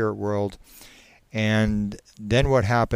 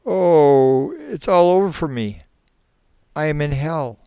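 moaning; 7 semitones higher, 7 dB louder than usual. Acted. Subject rocks in the chair in despair, moaning. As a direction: moan loudly, then let it sink. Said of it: "to moan".

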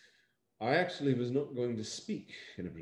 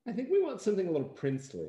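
quick, higher mock producer note; imitating the producer; 10 semitones higher, 5 dB louder, much faster than usual. Actor imitating the producer's note to him, quick mock voice. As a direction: rattle off the note like a nervous producer. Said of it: "producer's face and Danica's face is like".

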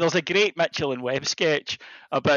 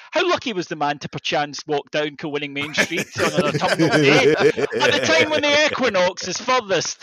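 nineteenth century fiction; 4 dB louder than usual. Retold or imitated. Husband answering his wife in nineteenth century prose. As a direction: intone it like a Victorian novel. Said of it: "And I'll say".

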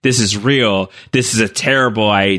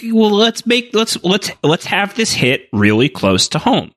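much higher, scheming villain voice, lightly done; Palpatine scheming; 7 semitones higher than usual. Retold or imitated. Explaining the Emperor's ploy to a co-host, a light scheming villain voice. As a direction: purr out the evil plan like a gloating schemer. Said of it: "that he's like".

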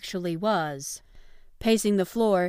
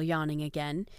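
ordinary delivery, nothing marked

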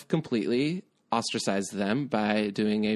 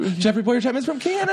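loud, high-pitched squeal of news; over-the-top outburst; 13 semitones higher, 5 dB louder than usual. Real, not acted. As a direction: blurt it out way too loud. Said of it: "That was too much".

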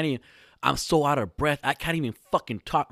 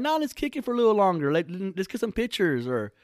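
high-pitched; 8 semitones higher than usual. Retold or imitated. His stalling reply, retold.

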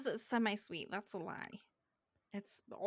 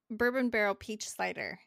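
ordinary talk, nothing marked

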